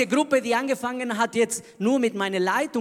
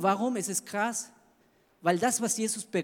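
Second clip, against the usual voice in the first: ordinary talk, nothing marked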